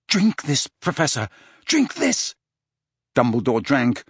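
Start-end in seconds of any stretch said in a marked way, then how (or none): 0.1-2.3 high, anxious insistence; tense, pleading order; urgent command. A narrator's reading. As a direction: press him to drink, pitch rising. Harry urges Dumbledore to drink.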